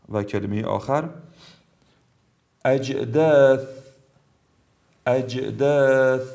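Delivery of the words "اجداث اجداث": اجداث with bounced ج and long "aa"; brief qalqala. Shown as a demonstration. Tajweed teacher gives last jim word, bouncing ج in اجداث. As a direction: release the ج of اجداث briefly, then draw out the vowel. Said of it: "مثالی از جیم".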